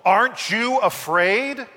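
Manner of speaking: high snarling question; snarled. A narrator's reading. Wolf snarls a threat at the calm sheep. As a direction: snarl the challenge upward. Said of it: "snarled the wolf".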